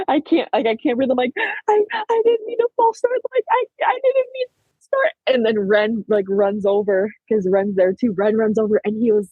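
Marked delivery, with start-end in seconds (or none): 0.1-5.1 high-pitched, panicked crying retelling. Retold or imitated. Athlete telling coach she can't breathe, crying. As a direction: act out sobbing, struggling to get the words out. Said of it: "crying. I'm like".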